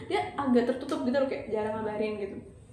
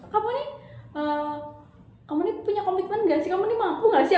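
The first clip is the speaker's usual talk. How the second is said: angry challenge; 7 semitones higher than usual. Retold or imitated. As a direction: challenge him with rising heat. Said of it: "Aku bilang kayak gitu loh".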